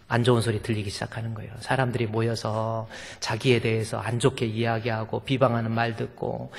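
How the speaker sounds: usual speaking voice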